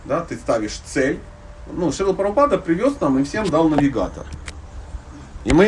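ordinary talk

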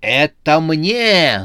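loud, high, drawn-out claim; stretched possessive shout; yelled. A narrator's reading. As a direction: bellow greedily for the parcel. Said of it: "заорал председатель".

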